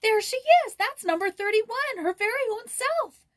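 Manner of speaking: very high, loud excited squeal; excited shriek. Acted. Joyce dancing before the portrait, very high excited squeal. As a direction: bounce with excitement as you say it. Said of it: "excitedly dancing up and down".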